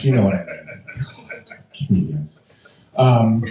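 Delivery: ordinary talk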